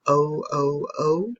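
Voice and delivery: "O" said as the long letter name, repeated; long O sound. Shown as a demonstration. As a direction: say "O, O, O" clearly for the class. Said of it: "It makes the".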